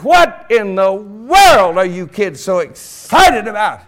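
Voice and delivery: loud, drawn-out exclamation; stretched, emphatic question; mock astonishment. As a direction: ask in exaggerated amazement. Retold astonished question to kids.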